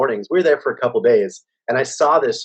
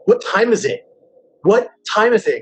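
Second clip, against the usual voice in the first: raised, insistent mock demand; mock mad; 8 semitones higher than usual. Retelling waking up mad at bandmates, mock mad. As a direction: retell it as an irritated, high-pitched question. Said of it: "mad at Kevin for something".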